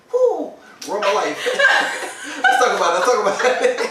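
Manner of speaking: laughing through fake heartbreak; laughing mock despair. Real, not acted. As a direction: ham up the tragedy, laughing.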